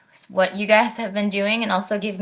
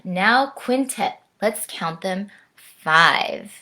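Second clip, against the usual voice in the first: lively, lilting read-aloud with swinging rhythm; sing-song picture book reading; reading to children; 3 dB louder, slower than usual. Acted. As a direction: perform the rhyme for little listeners, savouring each instrument. Reads picture book to kids.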